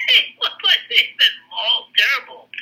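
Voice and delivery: amused retelling with laughter in the voice; laughing. Retold or imitated. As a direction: chuckle out the words in disbelief. Laughing while quoting the father.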